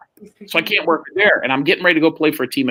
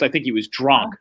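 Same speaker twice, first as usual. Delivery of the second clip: usual speaking voice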